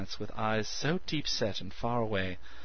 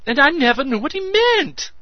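excited; 14 dB louder than usual. A narrator's reading. Meadows excited character voice.

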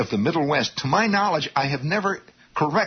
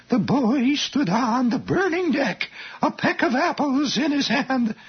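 amateur reading voice. Retold or imitated. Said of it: "they read like this".

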